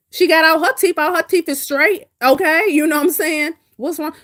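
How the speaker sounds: high, loud, incredulous protest; indignant defense. Real, not acted. Defending Royalty against talk about her mouth, high indignant.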